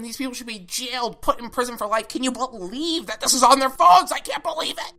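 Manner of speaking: high, hammed-up mock shock; sarcastic outrage. Reactor mocks the alarm over kids' compliments, sarcastic outrage. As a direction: overplay being utterly scandalized, drawing out the opening. Mocking overreaction to harmless compliments.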